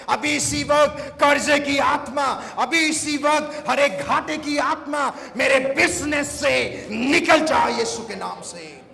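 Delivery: loud, high prayer of command; preaching at full force. Real, not acted. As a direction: drive it out forcefully, phrase on phrase. (ज़ोरदार आदेश भरी प्रार्थना)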